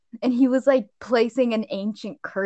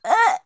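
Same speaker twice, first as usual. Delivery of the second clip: mock disgusted groan; 13 semitones higher, 8 dB louder than usual. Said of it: "And I was like".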